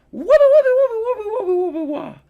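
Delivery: very high loud whooping noises; spinning kick noise. Retold or imitated. Imitating bicycle kick sound.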